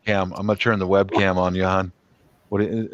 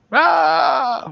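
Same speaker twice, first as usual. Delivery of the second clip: mock shriek; 3 dB louder than usual. Wife fleeing the camera.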